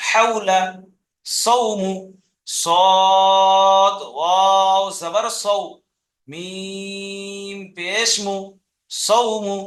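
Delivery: measured chant, "সদ" and "মিম" drawn out; spelled piece by piece. Shown as a demonstration. Teacher modelling word spelling for pupils, slow segmented chant. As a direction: hold the letter names, then join up "সৌমু" crisply as one word. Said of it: "কেটে কেটে বানান করবেন".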